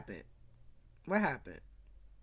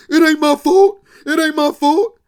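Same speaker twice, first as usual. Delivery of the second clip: loud, high whining protest; loud defensive protest; 7 semitones higher, 15 dB louder than usual. Retold or imitated. Brother loudly protesting to his sister. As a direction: shout his excuse defensively. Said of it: "Junior's like".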